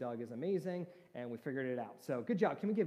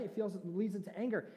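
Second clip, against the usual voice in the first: normal talking voice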